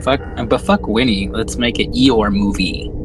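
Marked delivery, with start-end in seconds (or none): none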